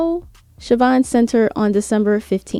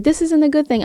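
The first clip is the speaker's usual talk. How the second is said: high, scolding mock tone; mock indignant quote; 7 semitones higher than usual. Vlogger mocks online critics, high indignant tone. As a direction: parody of people spinning words. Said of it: "I know people like to spin words like".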